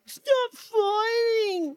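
very drawn-out high wail; hammed-up distraught little bear; wailing Baby Bear. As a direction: stretch it into a childish cry. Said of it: "And Baby Bear's all like".

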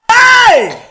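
very high, loud call-out; one sudden high holler; sharp shout. Real, not acted. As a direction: yell to stop him in his tracks. Calling out friend's side-eye.

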